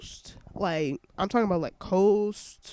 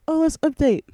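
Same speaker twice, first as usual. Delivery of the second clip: mock excited; 8 semitones higher, 7 dB louder, faster than usual. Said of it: "I was like".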